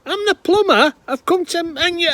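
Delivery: very high, full-volume yell straining to breaking; enraged plumber scream. Acted. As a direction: scream it until you collapse mid-sentence. Plumber screams until he collapses.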